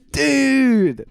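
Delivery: loud, high excited "Dude!"; excited shout. Real, not acted. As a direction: burst out with real delight. Excited outburst at discovery.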